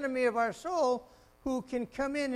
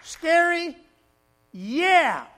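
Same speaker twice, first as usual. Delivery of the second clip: much louder, higher Scary; booming question; 6 semitones higher, 13 dB louder, much slower than usual. Real, not acted. Preacher teasing the congregation about fear, booming. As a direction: hit it like a jolt.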